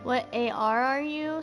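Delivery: ordinary delivery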